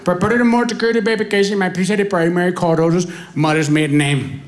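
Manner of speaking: put-on fast agent script in Indian accent; Indian call-centre accent. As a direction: rattle off the script like a phone agent. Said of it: "customer service representative".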